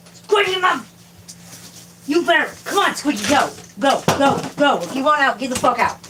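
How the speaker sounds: high-pitched exasperated yell, easing off; screaming at cat. Real, not acted. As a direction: holler at the cat to get out. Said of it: "I have to scream at my cat again".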